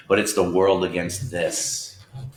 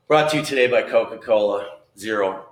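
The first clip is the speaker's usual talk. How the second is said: ordinary talk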